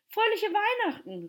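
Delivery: high, loud festive greeting; calling out. Acted. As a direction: call it out like cheering children. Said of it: "riefen sie".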